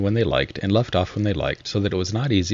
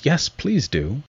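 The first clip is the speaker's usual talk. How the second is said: lightly voiced animal chorus, higher; a small crowd of critters chiming in together, higher; higher-pitched group plea from the animals; chorus of creatures; 8 semitones higher, much slower than usual. A narrator's reading. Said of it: "the others all joined in chorus".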